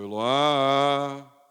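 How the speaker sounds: long, loud "Eloá" hail; sing-out call with a stretched last vowel on "Eloá"; familiar calling-out. Shown as a demonstration. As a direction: call "Eloá" up the stairs, holding the final á. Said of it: "quando eu subo a escada".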